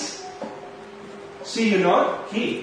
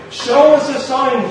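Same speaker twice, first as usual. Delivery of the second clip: higher, louder, slow taunting demand; demanding Pharisees; 5 semitones higher, 5 dB louder than usual. Acted. Preacher voicing the Pharisees testing Jesus, a loud, slow taunt. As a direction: challenge him to prove himself.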